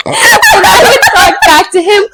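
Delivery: high, slow, laughing telling. Real, not acted. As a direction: giggle through the story.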